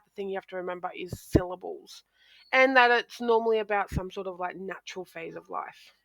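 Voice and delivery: normal talking voice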